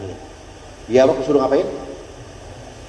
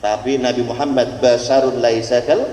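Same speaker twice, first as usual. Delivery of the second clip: normal talking voice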